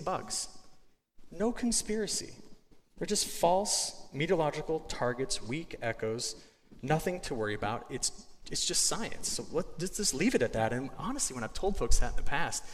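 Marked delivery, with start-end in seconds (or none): none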